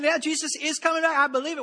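mock believer. Mocking a complacent believer.

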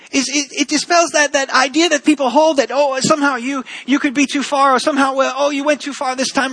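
full-force sermon peak; impassioned preaching. Acted. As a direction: drive the message home to the congregation. Preaching climax.

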